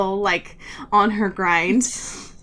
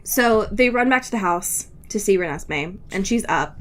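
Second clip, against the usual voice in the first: normal talking voice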